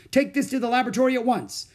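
high, fast, loud command; urgent order. Acted. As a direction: send him running, urgently.

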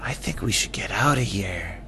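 scared hushed whisper. Acted. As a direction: whisper it nervously.

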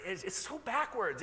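ordinary talk

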